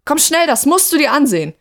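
high, urgent narrated shout; shouting back. A narrator's reading. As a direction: call someone over in alarm. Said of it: "schrie Kim zurück".

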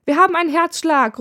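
high, loud call across the room. Acted. Nurse calling out to the team, loud and high. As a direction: shout the news to the whole ward. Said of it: "ruft der Krankenpfleger von eben".

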